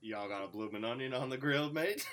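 bad Australian accent. Host asks his opinion, he answers in bad Australian accent. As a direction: play Mister Kangaroo in a poor Australian accent. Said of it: "Mister Kangaroo".

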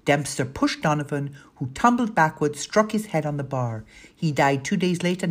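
ordinary delivery, nothing marked

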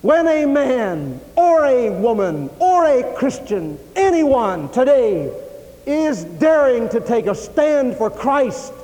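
slow, high, drawn-out pulpit cry; impassioned sermon climax; full-force preaching. Real, not acted.

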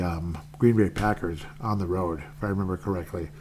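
usual speaking voice

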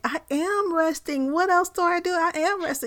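indignant, high and insistent; exasperated protest. Retold or imitated. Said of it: "And I'm like".

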